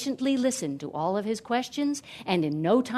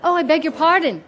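higher, louder flustered apology; startled apology; 6 semitones higher, 8 dB louder than usual. A narrator's reading. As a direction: apologise as if caught staring. Hero apologises to the talking tree.

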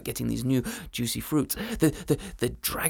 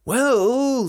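dragon voice; 7 semitones higher, 8 dB louder than usual. Acted. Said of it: "said the dragon".

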